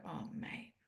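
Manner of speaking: put-on soft, near-whispered comfort for a hurting child; gentle comforting. Shown as a demonstration. Parent kneels to comfort son who wasn't picked, soft near-whisper. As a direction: hush it down and soothe, like kneeling beside him. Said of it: "take a deep breath, get down low and go".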